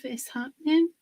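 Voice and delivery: ordinary talk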